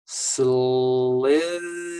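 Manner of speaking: "Slither" stretched sound by sound. Shown as a demonstration. Teacher breaking down a hard word, sounded out slowly. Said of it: "The L is L".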